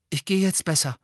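höher, eilig herausgesagt; hastig. A narrator's reading. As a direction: sich hastig verabschieden. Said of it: "sagte er hastig". (in Hast)

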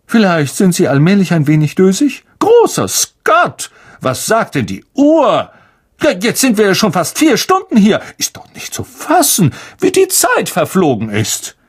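high, loud theatrical astonishment; surprised Lockhart. Acted. Lockhart exclaims over the late hour, high and theatrical. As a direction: exclaim grandly at the clock.

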